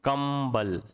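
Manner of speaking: कंबल with the m hummed through the nose; nasal demo word. Shown as a demonstration. Teacher showing students the nasal sound, kमbal demo. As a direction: say कंबल letting the nasal m ring. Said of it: "जैसे".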